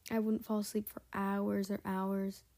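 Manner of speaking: ordinary delivery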